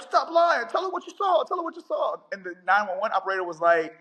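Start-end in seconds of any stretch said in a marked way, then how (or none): 0.0-2.2 much higher, insistent man's voice pushing her; husband yelling in background. Retold or imitated. Husband behind the caller, pressing her on the 911 call, high insistent voice. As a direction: urge her hard to tell the truth, pitched way up.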